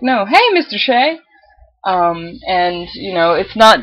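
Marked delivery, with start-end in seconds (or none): 0.0-1.2 lightly imitated coworker, pitched higher; self-correcting greeting in a raised voice; coworker correcting themselves. Coworkers switching from Miss to Mister, lightly imitated correction. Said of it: "they're like".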